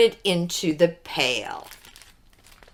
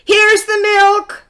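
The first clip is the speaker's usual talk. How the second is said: high loud excited shout; 12 semitones higher, 6 dB louder than usual. A narrator's reading. Jack bringing the milk to his mother, shouting. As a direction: call it out high and proud. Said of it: "shouted Jack".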